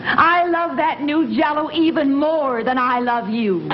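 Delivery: normal talking voice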